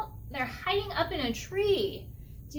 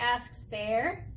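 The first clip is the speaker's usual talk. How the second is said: plain reading voice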